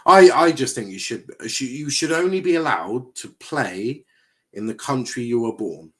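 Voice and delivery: ordinary talk, nothing marked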